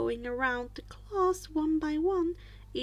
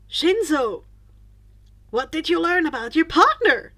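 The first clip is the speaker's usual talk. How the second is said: calling on a student; 4 semitones higher, 10 dB louder than usual. Acted. Teacher calls on student.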